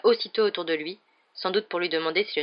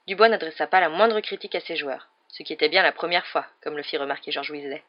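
plain reading voice

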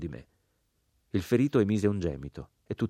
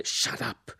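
ordinary delivery, nothing marked

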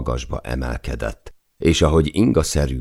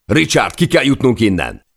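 high, loud shouting over wind and waves; urgent shout; 10 semitones higher, 5 dB louder than usual. A narrator's reading. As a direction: yell the order to Richard so he moves. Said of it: "üvöltötte Per". (sürgető, magas, hangos kiáltás)

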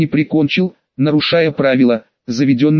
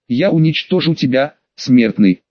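plain reading voice

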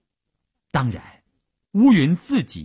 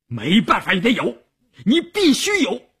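high, forceful order, climbing higher; furious insistence; 11 semitones higher, 3 dB louder than usual. A narrator's reading. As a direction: override the protest with a fierce command. Officer overrides doctor's protest. (厉声强令)

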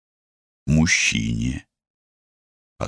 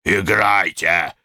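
hoarse yell; 3 semitones higher, slower than usual. Acted. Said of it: "хрипло заорал он".

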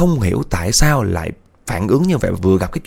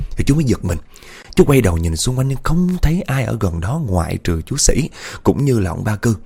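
usual speaking voice

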